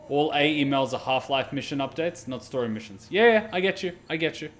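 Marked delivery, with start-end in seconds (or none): none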